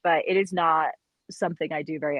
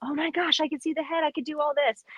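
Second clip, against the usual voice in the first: retold excitement; 8 semitones higher than usual. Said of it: "her mom actually told her".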